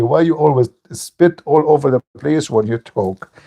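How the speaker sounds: ordinary delivery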